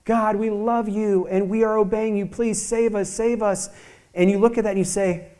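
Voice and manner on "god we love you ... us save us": crying out pleadingly. Acted. Sinking humanity cries out to God to save them, pleading. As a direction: cry out to be rescued, voice held high. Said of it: "they're crying out saying".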